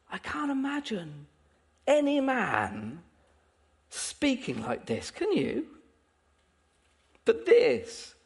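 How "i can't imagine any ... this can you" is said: hushed wonder. Real, not acted. Same thought, brought down to hush.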